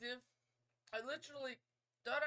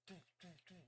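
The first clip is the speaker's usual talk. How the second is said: three soft "doo" thuds; imitated footsteps; 3 semitones lower, 16 dB quieter than usual. Player imitating ghost steps behind them, very soft thuds. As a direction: mimic creeping steps, much softer. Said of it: "like it's stepping on the steps".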